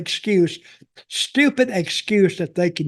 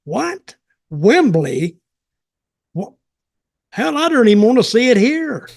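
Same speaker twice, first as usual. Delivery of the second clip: incredulous; 4 semitones higher, 4 dB louder than usual. Retold or imitated. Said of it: "and I go".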